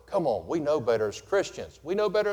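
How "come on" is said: usual speaking voice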